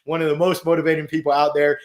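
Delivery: ordinary delivery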